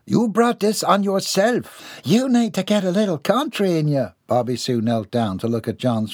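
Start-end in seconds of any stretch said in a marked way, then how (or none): 0.1-4.1 sassy country woman. Acted. Bobby Sue teases John about his weakness. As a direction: tease him from above.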